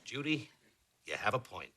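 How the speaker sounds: soft, low placating tone; calm. Acted. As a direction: concede it gently and evenly. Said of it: "When I calmly say".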